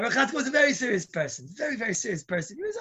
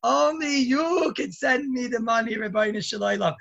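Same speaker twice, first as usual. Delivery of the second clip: slow, loud drawn-out plea; pleading cry to heaven; 6 semitones higher, 3 dB louder, slower than usual. Retold or imitated. Peddler crying his prayer upward, slow and loud. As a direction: cry out to the Master of the World. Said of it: "I was not screaming at them, but I was saying".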